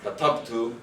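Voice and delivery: "katabtu" with stress on TAB; penultimate stress. Shown as a demonstration. Lecturer showing Arabic heavy-syllable rule, penultimate stress. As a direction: lean on the middle syllable of "katabtu". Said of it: "stress on the second last syllable".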